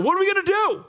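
scared young servant, mock panic; panicked cry. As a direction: squeal it like a terrified sidekick.

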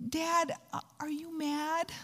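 put-on high, soft, timid little girl. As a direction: ask daddy in a small, nervous voice. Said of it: "little girl kind of sound in her voice".

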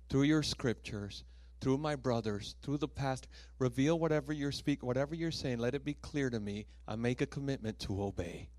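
prayer. Acted. Leading prayer commitment.

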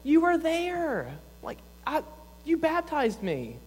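high, slow, hammed-up indignant voice; mock defensive Jesus. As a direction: play a huffy, put-out Jesus for laughs. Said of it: "if I was Jesus, I'd be like".

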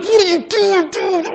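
friend's exasperated high-pitched complaint; mad friend. Retold or imitated. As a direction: imitate an irritated buddy snapping. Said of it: "so mad at me".

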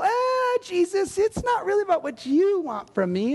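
self-centred shrug in the voice; mock dismissive. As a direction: wave him away lightly. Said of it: "And we go".

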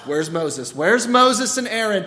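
loud, high, accusing shout; the mob hunting Moses to blame him; angry crowd. Acted. Israelites shout angrily, hunting Moses to blame him. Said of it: "They're angry at Moses".

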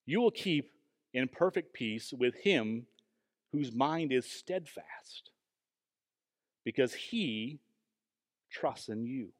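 slow, deliberate verse reading with long pauses; measured recitation, letting each phrase settle; scripture reading. Acted. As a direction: read the Bible verse slowly, phrase by phrase. Reads the verse aloud.

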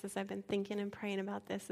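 usual speaking voice

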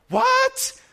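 high, loud outburst of disbelief; mad brother. Retold or imitated. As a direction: blurt it out as an outraged, high-pitched yell. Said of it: "he gets mad at me".

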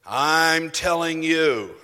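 slow, loud, emphatic insistence; imitating a booming preacher's slow rebuke; vociferous preacher. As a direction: drive it home slowly like a preacher pointing at you. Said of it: "very vociferous".

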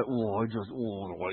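low, soft wordless moaning; grieved moan. Shown as a demonstration. Showing the guttural moan of a grieved complainer, low and soft. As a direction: moan it deep, too grieved for words. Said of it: "this guttural kind of moaning".

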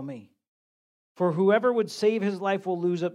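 a pastor reading the gospel aloud from the pulpit; scripture reading. Acted. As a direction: read the passage out to the congregation. Reads Mark 8 aloud.

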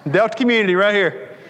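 joking that he gives through Delta Community every month; mock announcer. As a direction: plug the bank like a hammed-up ad. Mock bank announcement, laughing.

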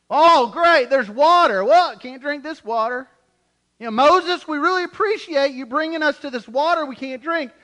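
loud, high mock gratitude dripping sarcasm; sarcastic complaining. Hebrews mocking Moses over undrinkable water, loud and sarcastic. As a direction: sneer your thanks at Moses. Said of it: "their sarcasm came out".

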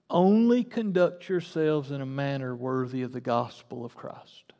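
usual speaking voice

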